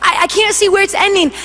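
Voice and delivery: ordinary talk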